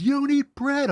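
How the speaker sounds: very high mimicking retort; high-pitched comic comeback; Mama Soy. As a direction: imitate a partner talking back in a high voice. Imitates Mama Soy's retort.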